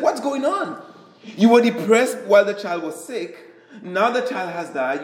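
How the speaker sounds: high-pitched, incredulous mock questioning; baffled protest. Servants question David after his child dies, baffled mock protest.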